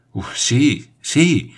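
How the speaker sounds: out of breath, rising high on the second sí; very flustered. Acted. Paganel, breathless after falling, answers the major flustered. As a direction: puff it out after the fall. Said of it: "muy sofocado".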